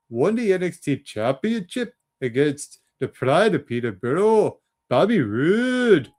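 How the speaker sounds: slow, drawn-out Scottish announcer voice with a big high start; hammed-up Scottish accent, stretching each name. Host calls the NXT main event in a Scottish accent. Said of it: "that's my Scottish accent for you".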